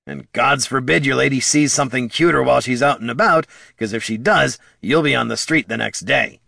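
aggrieved little kobold voice; kobold Bert, worked up. Acted. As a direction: rattle off the hardships indignantly.

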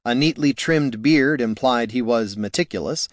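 plain reading voice

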